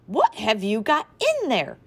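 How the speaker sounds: loud, slow alarmed question; mother yelling at her son's mouth, alarmed; yelled. A narrator's reading. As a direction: shout it in shock. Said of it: "she yelled".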